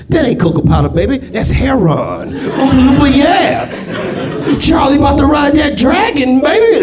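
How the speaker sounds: high, hollered seventies jive; jive Oompa Loompa. Acted. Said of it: "Here come the Oompa Loompas".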